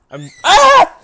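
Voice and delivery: very high, loud shriek of fright; terrified scream. Real, not acted. Streamer frozen in place, screaming at the final scare.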